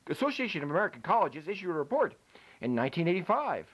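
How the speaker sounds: normal talking voice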